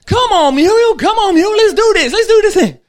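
high, loud, shouted begging; old husband begging his wife to take the free ride; excited pleading. Acted. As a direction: plead like a kid who wants a ride. Husband keeps begging in joke.

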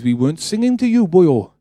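put-on lilting Welsh accent, pitch jumping up. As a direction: imitate a Welshman putting someone straight. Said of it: "he said in the Welsh accent".

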